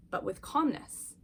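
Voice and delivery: usual speaking voice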